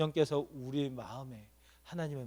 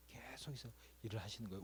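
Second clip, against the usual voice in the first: hushed and intimate; whispered; 7 semitones lower, 18 dB quieter than usual. Real, not acted. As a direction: whisper it like a secret. Preacher hushes a key line. (속삭이며)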